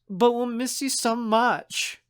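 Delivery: high, drawn-out pouty whine; clingy, plaintive girlish complaint; whining. Acted. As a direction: plead slowly, pitched well up. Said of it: "Mads whines".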